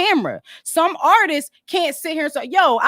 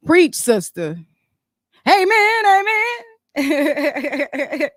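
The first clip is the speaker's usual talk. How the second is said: church amen; 3 semitones higher, much slower than usual. Pretend. Calling out like church crowd.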